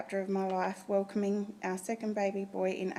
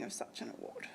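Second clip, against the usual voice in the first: emotional; 12 dB quieter than usual. Real, not acted. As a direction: voice catching with real emotion. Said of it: "sorry, I'm a bit emotional".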